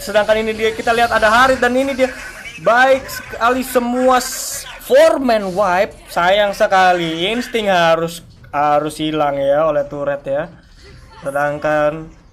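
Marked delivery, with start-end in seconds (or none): 0.0-10.5 live play-by-play. Real, not acted. Teamfight play-by-play.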